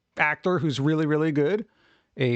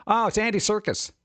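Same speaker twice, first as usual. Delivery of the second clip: ordinary talk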